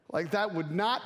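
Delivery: ordinary talk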